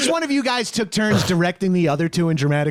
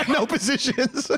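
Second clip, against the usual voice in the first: laughing, slow and higher; 10 semitones higher, slower than usual. Real, not acted. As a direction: giggle it out in mock seriousness. Mocking the noncommittal stance.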